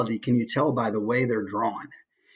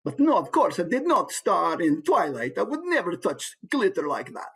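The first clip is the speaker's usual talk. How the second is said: higher, haughty vampire protest; 3 semitones higher than usual. Acted. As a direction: play an affronted vampire. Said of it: "Fang's like a vampire".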